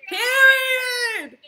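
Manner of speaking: high, loud whoop of approval; real excited yell; triumphant shout. Real, not acted. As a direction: belt it out like a catchphrase. Cheering at being shown money.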